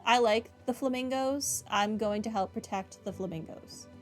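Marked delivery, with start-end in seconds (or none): none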